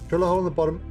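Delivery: normal talking voice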